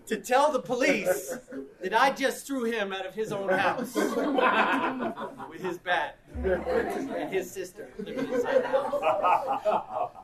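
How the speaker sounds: chuckling as you tell the absurd bit; laughing. Real, not acted. Laughing while retelling cat story.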